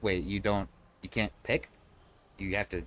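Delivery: ordinary talk, nothing marked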